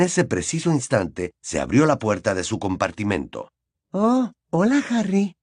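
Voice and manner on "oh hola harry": put-on much higher small girl voice; tiny shy girlish greeting. A narrator's reading. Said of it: "lo saludó una vocecilla".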